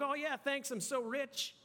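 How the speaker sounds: mock host boasting about wealth; smug host. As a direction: brag lightly about being rich. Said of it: "they're like".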